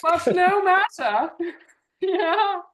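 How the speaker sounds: high laughing villain. Acted. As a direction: brush it off with a laugh. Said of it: "this is a prime villain character".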